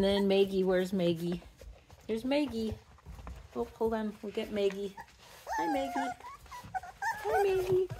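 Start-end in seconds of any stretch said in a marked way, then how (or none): 5.6-7.9 sing-song high greeting to a pet; puppy talk. Real, not acted. Cooing to a puppy.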